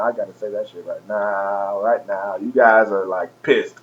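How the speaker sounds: low, soft trailing remark; quiet, low confiding tone; hushed aside. Real, not acted. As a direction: drop right down in pitch and volume. Drops into aside about angry fans.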